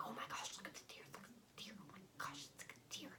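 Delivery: hushed, excited whisper, imitating deer spotters; mock awed whisper. Imitating out-of-staters spotting a deer, excited whisper. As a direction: whisper as if not to scare the deer.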